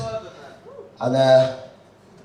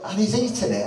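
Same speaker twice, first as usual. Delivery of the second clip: ordinary talk, nothing marked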